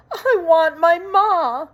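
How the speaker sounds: high, slow wailing plea; a child crying for her mother. Acted. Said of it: "cried Mig".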